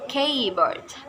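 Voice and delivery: keyboard with KEY stressed; keyboard, weight on the first part; stress on first term. Shown as a demonstration. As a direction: lean on KEY in keyboard, then fall. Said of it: "stress placement is in the first term".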